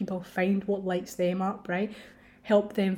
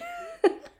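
high burst of laughter. Real, not acted. Podcaster amused at her own ego's protest, laughing.

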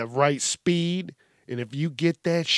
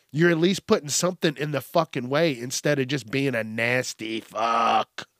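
ordinary talk, nothing marked

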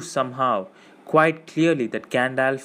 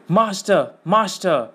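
high, urgent hissing cry; hissed alarm; 7 semitones higher, 4 dB louder than usual. A narrator's reading. Gollum warning his master, hissing urgently. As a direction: hiss the word out in alarm. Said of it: "he hissed".

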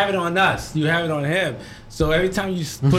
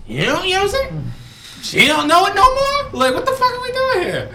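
excited rant; 11 semitones higher, 4 dB louder than usual. Real, not acted. Ranting about the show.

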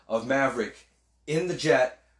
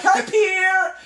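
mock Sabina greeting; 9 dB louder than usual. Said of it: "It's like".

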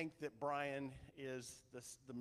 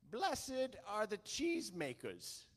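slow, high, hammed-up English accent; Monty Python style English accent, slow and raised; mock English accent; 7 semitones higher, 6 dB louder, slower than usual. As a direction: relay it loudly in an amateur English accent. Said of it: "I don't do the English accent very well".

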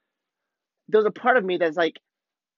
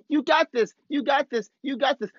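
a touch higher, repeated cheering, not truly shouted; mild mock chant of encouragement; imitating a yell; 8 semitones higher than usual. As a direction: surfer buddy chanting encouragement, lightly voiced. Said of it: "he keeps on yelling".

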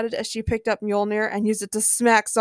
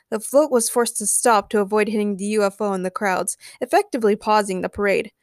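usual speaking voice

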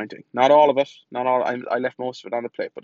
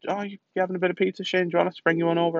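higher, quick, chirpy imitation; mock coworker voice; 7 semitones higher, much faster than usual. Coworkers offering pizza to the dieter, high quick mock voice. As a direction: breeze through it like a tempting colleague.

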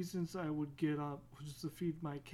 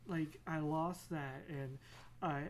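normal talking voice

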